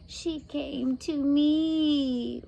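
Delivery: high, slow rasping witch; rasped. A narrator's reading. Witch Beryl rasps her claim to the girl. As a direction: rasp it out like a hag. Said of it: "Beryl rasped".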